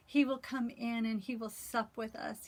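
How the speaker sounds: usual speaking voice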